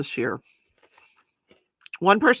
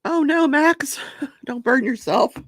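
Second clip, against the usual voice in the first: sudden high alarmed cry; high startled warning to the dog; 9 semitones higher, 5 dB louder than usual. Real, not acted. Dog near something hot.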